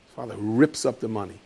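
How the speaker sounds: ordinary talk, nothing marked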